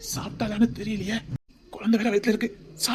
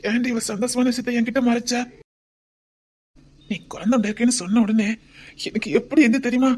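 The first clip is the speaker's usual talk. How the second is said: usual speaking voice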